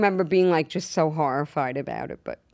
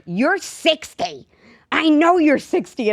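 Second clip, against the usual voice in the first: slow, higher, insisting she knows; insistent agent; 9 semitones higher, 5 dB louder, slower than usual. Retold or imitated. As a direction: mimic the agent, high and certain.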